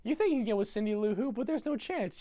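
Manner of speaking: put-on high, fast taunting kid voice; high nasal-free taunt of a mean kid; mocking bully. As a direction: sneer it up high like a schoolyard bully. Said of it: "he goes".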